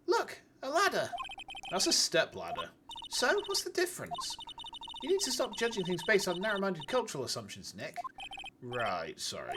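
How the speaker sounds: reading game dialogue in character voices; voicing game characters. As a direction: act out each character in turn.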